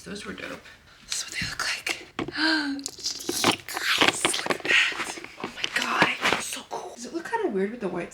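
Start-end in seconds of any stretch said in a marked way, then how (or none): 1.1-2.1 whisper. Real, not acted. Whispering while showing laces. 2.2-3.9 gasp. Real, not acted. Reacting to shoelaces. 4.0-6.9 whisper. Real, not acted. Whispered awe at laces.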